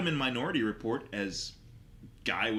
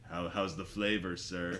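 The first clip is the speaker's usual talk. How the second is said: creepy low caller; 6 semitones lower, 5 dB quieter than usual. Pretend.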